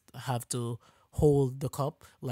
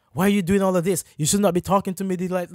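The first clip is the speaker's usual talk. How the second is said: louder, higher indignant protest; mock shocked customer; 4 semitones higher, 5 dB louder than usual. Customer shocked at getting attitude back, mock indignation. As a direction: imitate a customer taking offense. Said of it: "shocked when you return the same energy".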